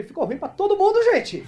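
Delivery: high, loud exasperated quip; hammed-up outburst to everyone; mock exasperation. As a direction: throw it out like an exasperated joke. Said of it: "Eu falei".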